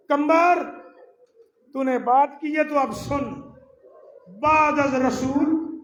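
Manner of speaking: high, loud summons, slowing down; grieving master calling out; forceful call. Acted. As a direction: call his name out with grief. Ali addresses Qambar forcefully.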